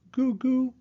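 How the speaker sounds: put-on high baby gurgle; baby babble. A narrator's reading. Baby George babbling at his toy, high and cooing.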